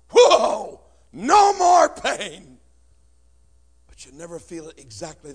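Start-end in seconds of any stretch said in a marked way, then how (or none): 0.1-2.6 high, slow, loud sigh of relief; euphoric relief. Acted. Preacher acting out the first Oxycontin hit, blissful shout.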